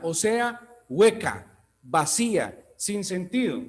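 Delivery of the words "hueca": ordinary talk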